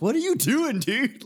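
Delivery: high incredulous what are you doing; disbelieving cry at his buddy; retelling his shock at a friend, high and incredulous. Retold or imitated. Retold shocked outburst at friend.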